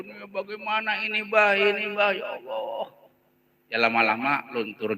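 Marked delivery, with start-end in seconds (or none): 0.0-2.9 hammed-up whine, much higher in pitch; mock children crying. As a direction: imitate distraught children wailing to their father. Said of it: "nangis semua anak-anaknya".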